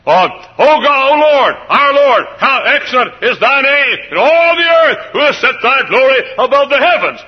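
high, loud pulpit recitation; preaching psalm. Acted. Preacher proclaims the psalm to his congregation at full force. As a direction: proclaim it to the rafters.

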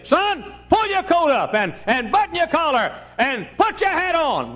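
very high, loud mama voice; hollering mother. Retold or imitated. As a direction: holler orders at your son like a fussing mother. Mom would say.

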